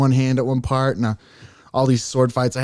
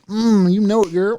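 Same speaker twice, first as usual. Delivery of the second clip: sassy self-satisfied; 9 semitones higher, 4 dB louder than usual. Retold or imitated. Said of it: "I'm like".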